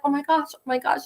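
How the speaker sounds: high, hushed mock panic; small high voice, acting terrified. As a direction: re-enact a scared child's frantic muttering. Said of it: "I'm so terrified".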